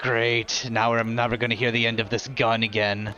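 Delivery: a heavy-sighing grumble; weary sigh. Acted. Arvis about Kaltog's gun, a mild weary sigh. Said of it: "gives a heavy sigh".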